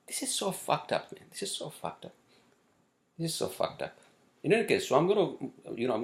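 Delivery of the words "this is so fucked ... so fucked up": whispered in disbelief; exasperated whisper. Real, not acted. Venting to viewers about the crash, exasperated whisper. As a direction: breathe it out, fed up and unvoiced.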